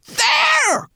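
high, sharp cry of alarm. A narrator's reading. Twigleg to the others, pointing ahead with trembling fingers, alarmed cry. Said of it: "cried Twigleg".

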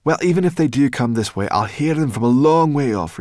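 high, loud creature voice; confident brownie girl. Acted. Sorrel reassuring the dragon about humans, brisk and loud. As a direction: call back, brushing off the worry.

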